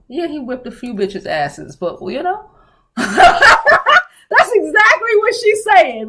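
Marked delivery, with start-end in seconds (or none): 3.0-6.1 slow, high, laughing. Real, not acted. Laughing at her own joke about the caller. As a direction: laugh through it.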